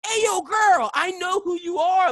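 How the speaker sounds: high, loud shout to a stranger; calling out. Retold or imitated. Hero hailing the girl before she leaves, calling out. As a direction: holler it to get her attention.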